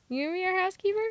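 mocking Hugh Laurie. Baron offering Cousin Bet a housekeeper job, mock sweet. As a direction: sneer the question sweetly. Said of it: "Like, we can't".

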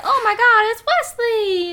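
high, loud swooning exclamation; mock excited cry. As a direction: gush like a lovestruck heroine. Buttercup's recognition retold.

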